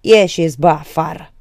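loud, haughty dismissal; contemptuous. A narrator's reading. Victor again throwing Birică out, contemptuous. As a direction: order them out with scorn. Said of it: "Spuse cu același dispreț".

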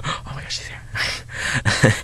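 hushed, mostly breath; mock gasped whisper. Mom on the ground as door bursts open, mock hushed gasp. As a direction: imitate a faint, whispered exclamation. Dying mother retold, barely voiced.

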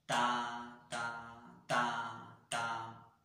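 strong-weak-strong-weak "ta"; accents on one and three. Shown as a demonstration. Teacher demonstrating accents on one and three, alternating loud "ta". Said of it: "si estuvieran en el uno y en el tres".

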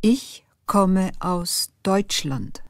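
ordinary talk, nothing marked

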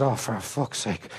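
low, slow, fed-up curse under the breath; exasperated groan. Retold or imitated. Father hearing his son was suspended, low and fed up. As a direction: let it sag out of you in despair.

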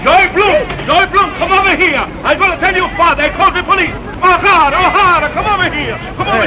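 loud, high call across the street. Acted. Woman calling the boy and the officer from afar, loud call. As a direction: shout the names to get attention.

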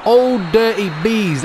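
slow, loud jeer; taunting the rival club; mocking drawl. Real, not acted. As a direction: drag out the insult. Mocking rival team name.

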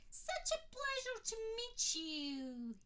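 high, slow, gushing with delight; drawn-out fawning high voice; keen admirer. A narrator's reading. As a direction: starstruck man greeting a celebrity, high and slow.

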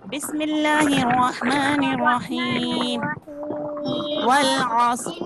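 slow, drawn-out Quranic recitation; teacher reciting for children to repeat. Shown as a demonstration. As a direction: chant it slowly and evenly for the class to follow. Teacher recites Surah Asr.

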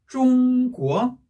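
"중국어" said as Chinese zhōngguó, high level then rising; first-second tone. Shown as a demonstration. Teacher drilling the pattern, "중국어" level then rising. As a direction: slowly hold "중" high, then lift "국어". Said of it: "일 성, 이 성일 때는".

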